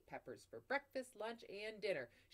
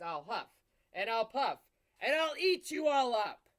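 building louder and higher hammed-up growl; mock wolf threat; 5 semitones higher, 15 dB louder than usual. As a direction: threaten to eat them, all in fun. Playful wolf threat to children.

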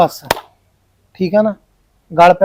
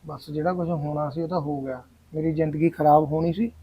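gloomy lament; 5 semitones lower, 12 dB quieter than usual. Acted. Groom laments his ruined life.

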